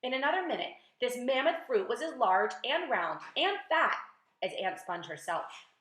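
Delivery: ordinary delivery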